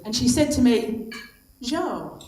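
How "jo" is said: lightly put-on French accent; a hint of a French ski instructor. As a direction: attempt a soft French accent. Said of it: "in her French accent".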